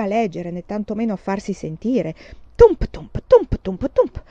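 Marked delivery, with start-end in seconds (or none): 2.5-4.2 loud rhythmic thumping; strong beat, weaker echo; bench thumps. A narrator's reading. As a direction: bang out the rocking bench in pairs. Imitated rocking bench.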